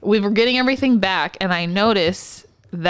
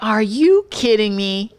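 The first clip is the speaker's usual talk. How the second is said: slow, drawn-out mock incredulity; retold disbelief; slower than usual. Therapist reacting to the nurse, slow retold disbelief. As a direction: stretch it out in disbelief.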